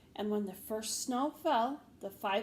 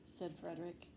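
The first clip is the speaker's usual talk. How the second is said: ordinary delivery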